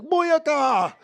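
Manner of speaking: high, loud Jamaican shout; hammed-up Jamaican exclamation; Jamaican accent. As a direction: holler it out in mock Jamaican. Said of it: "We be whole Jamaicans at home like".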